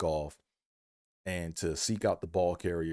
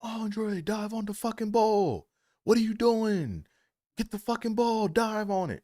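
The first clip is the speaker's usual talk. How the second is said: coaches shouting. Retold or imitated. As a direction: bellow it from the sideline. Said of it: "shouting out".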